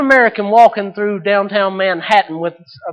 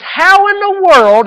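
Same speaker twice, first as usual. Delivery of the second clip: loud, high, drawn-out disbelief; astonished; 12 semitones higher, 9 dB louder, slower than usual. Acted. Businessman amazed his companion heard a cricket, astonished and loud.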